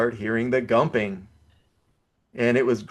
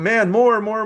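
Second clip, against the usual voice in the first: excited; 10 semitones higher, 6 dB louder, slower than usual. Retold or imitated. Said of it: "I'm sitting there going".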